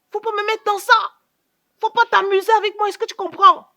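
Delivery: loud stern threat, last question slowed; angry warning. Acted. Aunt warning her niece not to play with her, loud and stern. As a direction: lay down the law hard.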